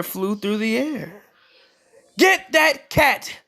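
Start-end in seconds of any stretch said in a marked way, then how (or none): 2.2-3.4 slow, high shouted command. A narrator's reading. Servant yelling at the others to catch the cat, high. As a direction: yell to chase the cat. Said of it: "a servant shouted".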